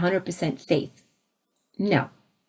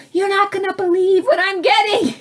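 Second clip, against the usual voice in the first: excited; 11 semitones higher than usual. Retold or imitated. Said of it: "called Dave and woke him up".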